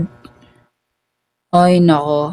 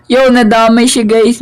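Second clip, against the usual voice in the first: louder, higher call to the viewers; excited; 4 semitones higher, 5 dB louder than usual. Real, not acted. Streamer calling out to viewers, excited. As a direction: tell everyone what just happened. Teammate dies too.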